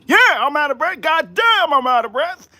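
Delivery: high-pitched indignant comeback; exasperated admission. Real, not acted. Streamer answering critics about being out of breath, exasperated.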